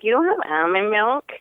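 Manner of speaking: mock a petty coffee-shop complaint; whining. Whining to the barista about almond milk, mock whiny. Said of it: "whining at the coffee bean".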